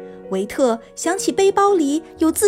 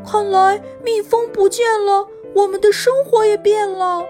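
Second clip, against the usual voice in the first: put-on frowning boy; 5 semitones higher than usual. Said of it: "维特皱着眉头说".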